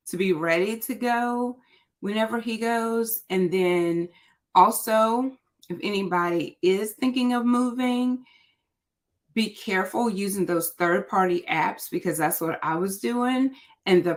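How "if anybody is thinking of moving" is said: ordinary delivery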